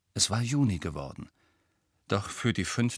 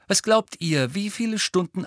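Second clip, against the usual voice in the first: higher, keen, pressing question; obsessive eagerness; 10 semitones higher, 4 dB louder than usual. A narrator's reading. Ernie quizzing Harry and Ron on revision, obsessively eager. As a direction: quiz them with obsessive interest. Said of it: "mit einem besessenen Glitzern".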